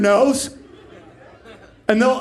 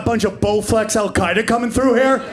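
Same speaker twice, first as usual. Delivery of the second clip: ordinary talk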